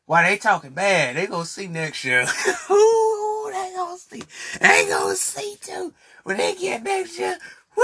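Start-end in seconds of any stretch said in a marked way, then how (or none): 2.7-7.8 high, giggling taunt; laughing warning. Real, not acted. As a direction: gloat through the laughter. Gleeful laughing threat.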